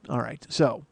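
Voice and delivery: ordinary talk, nothing marked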